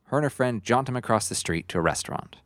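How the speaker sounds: normal talking voice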